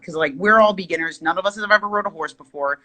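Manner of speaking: ordinary delivery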